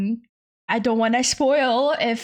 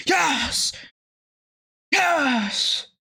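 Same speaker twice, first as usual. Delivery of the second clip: overjoyed yes; excited cheer; 2 semitones higher, much slower than usual. Real, not acted. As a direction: shout it in pure delight. Cheering for a cheese tax.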